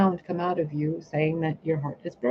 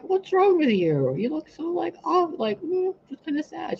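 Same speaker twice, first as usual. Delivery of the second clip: mock concerned voice; 12 semitones higher than usual. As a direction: play up a sweet worried tone to the old lady. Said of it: "I'm like".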